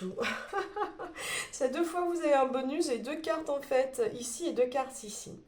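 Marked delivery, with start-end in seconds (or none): 0.2-1.5 little breathy laugh. Real, not acted. Laughs at the bonus cards.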